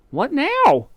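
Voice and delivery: high little bird voice; bird asking. A narrator's reading. The Roly-Poly Bird asks what next, high. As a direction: pipe up breathlessly. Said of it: "asked the roly-poly bird".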